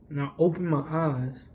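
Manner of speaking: usual speaking voice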